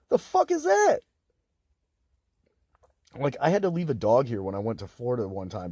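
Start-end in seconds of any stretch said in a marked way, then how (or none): 0.1-1.0 high, loud squeal of disbelief; outraged disbelief. Real, not acted. Speaker ranting about dad's advice, high appalled squeal. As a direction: shriek the question, appalled.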